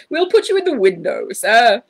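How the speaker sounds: high, loud grand announcement; announcing. A narrator's reading. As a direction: proclaim it to the room. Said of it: "announced the manager".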